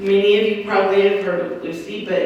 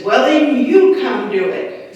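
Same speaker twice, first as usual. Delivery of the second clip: louder, higher, drawn-out mock challenge; exasperated retort; 5 semitones higher, 6 dB louder than usual. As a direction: throw it back as an acted comeback. Brother's exasperated retort to Grandpa's advice.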